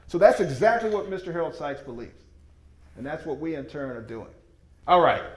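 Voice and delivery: ordinary talk, nothing marked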